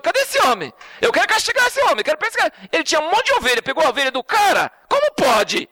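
high indignant outburst rising to a shout; outraged king. Retold or imitated. King David demanding the rich man be punished, high indignant shout.

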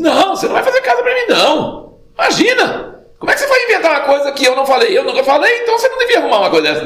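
very high, fast comic scolding; mock telling-off. God refusing David's house idea, mock scolding. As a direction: rattle off an exaggerated, squeaky reprimand. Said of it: "ele tá dando a bronca".

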